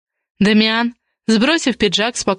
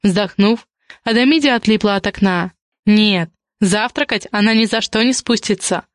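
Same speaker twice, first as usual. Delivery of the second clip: plain reading voice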